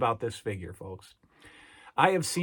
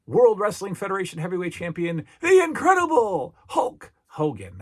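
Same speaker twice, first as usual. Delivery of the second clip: ring announcer impression; 6 semitones higher, 7 dB louder than usual. Fan recalling the announcer crowning Hulk Hogan, booming. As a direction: boom it out like an arena announcer. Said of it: "first time that Howard Finkel sticks".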